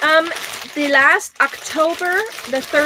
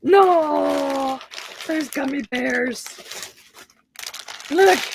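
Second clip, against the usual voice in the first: gasping surprise; 3 semitones higher, much slower than usual. Real, not acted. Finding gummy bears in the box.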